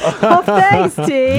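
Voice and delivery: high, laughing thanks. Real, not acted. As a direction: laugh it out, flattered. Compliment on singing.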